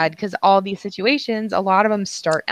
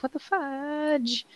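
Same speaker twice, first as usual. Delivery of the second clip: high, hushed, drawn-out disbelief; muted inner exclamation; 6 semitones higher, 10 dB quieter, much slower than usual. Retold or imitated. Woman retelling her silent reaction to a line-cutter, hushed disbelief. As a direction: draw it out softly, as a thought kept inside. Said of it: "Like, in my head".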